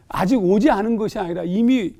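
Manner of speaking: ordinary talk, nothing marked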